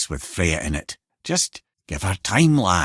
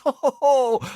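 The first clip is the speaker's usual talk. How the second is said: laughing ho ho ho, much higher and louder; gleeful chuckle; 12 semitones higher, 4 dB louder than usual. Acted. Head gloating that they fooled Odin under his nose, gleeful laugh. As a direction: chortle with delight at the trick.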